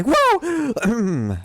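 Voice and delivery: loud startled shout; startled yell. Real, not acted. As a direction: jump in fright and yell. Jump scare.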